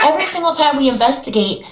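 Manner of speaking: ordinary talk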